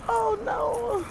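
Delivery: high dismayed cry at the bus; oh no, there goes the bus. Real, not acted. Speaker spotting the bus she is missing, high dismayed oh no. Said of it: "It's Mister Bus".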